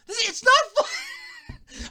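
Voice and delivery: high, slow words breaking into laughter; can't get it out for laughing; laughing shout. Real, not acted. Cracks up mid-sentence.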